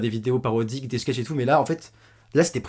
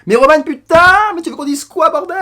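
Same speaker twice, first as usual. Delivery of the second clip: exasperated shout; 14 semitones higher, 11 dB louder than usual. Real, not acted.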